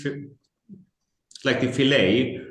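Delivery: usual speaking voice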